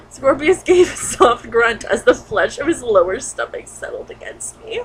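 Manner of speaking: ordinary talk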